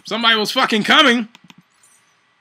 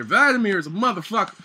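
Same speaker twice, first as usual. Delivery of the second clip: ordinary talk, nothing marked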